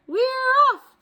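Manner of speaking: very high, loud shout; crew shout. Acted. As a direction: cheer it out together. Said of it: "we all shouted".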